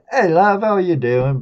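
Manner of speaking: a chat-up line in a put-on Aussie accent. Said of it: "I'd try to do, uh, an Aussie accent".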